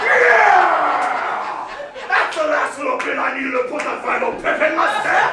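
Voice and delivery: high, loud mock hype with laughter; overjoyed gym-bro cheer, laughing; hammed-up cheer. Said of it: "I wasn't supposed to go".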